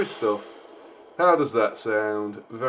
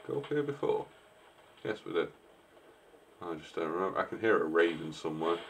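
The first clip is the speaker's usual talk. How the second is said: ordinary talk, nothing marked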